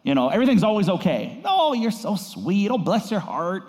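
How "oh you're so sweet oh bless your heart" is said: lightly hammed-up Southern accent, high and slow; sweet mock-Southern belle, pitched up. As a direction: pastor teasing Southerners in a put-on Southern accent. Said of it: "Love my Southern accent".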